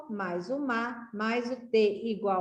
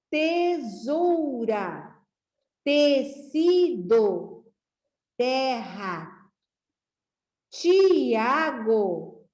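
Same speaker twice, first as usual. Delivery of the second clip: put-on each word drawn out slowly for children to repeat; slow read-along; 3 semitones higher, 5 dB louder, much slower than usual. Shown as a demonstration. Teacher reading a word list with young pupils, slow and clear. Vamos ler então comigo?.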